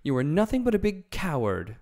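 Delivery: Dorothy scorning the lion; scornful. A narrator's reading. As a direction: call him a coward to his face. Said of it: "she retorted".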